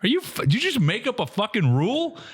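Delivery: high, indignant rebuke; a retold outraged callout to a player; mock outraged rebuke. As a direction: call him out, incredulous. Retelling angry rebuke.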